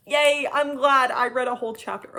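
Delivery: loud mock-cheerful yay; sarcastic cheer. Real, not acted. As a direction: fake enthusiasm. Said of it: "Okay, but seriously".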